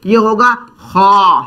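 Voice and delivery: खा said with a heavy throaty kh; thick back-of-throat खा; heavy kha. Shown as a demonstration. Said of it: "मोटी आवाज है".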